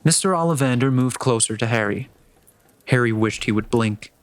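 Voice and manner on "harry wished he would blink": plain reading voice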